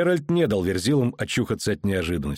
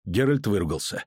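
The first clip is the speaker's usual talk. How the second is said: ordinary delivery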